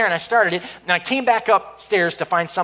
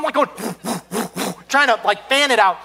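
ordinary talk

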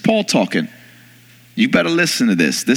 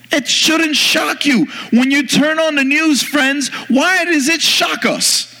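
high-pitched, emphatic sermon delivery; impassioned preaching; 7 semitones higher than usual. Real, not acted. Preacher urging believers to open their eyes, impassioned preaching. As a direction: preach it urgently, pitched well up.

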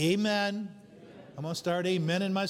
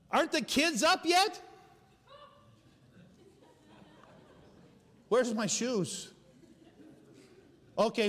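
high calling shout through the house; yelling across the room; 7 semitones higher, slower than usual. Retold or imitated. Parent rushing family before church.

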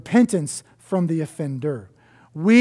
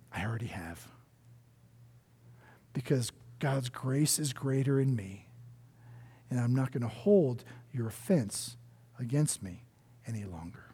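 soft gracious reassurance; 7 semitones lower, 12 dB quieter than usual. Retold or imitated. Gracious reply voiced.

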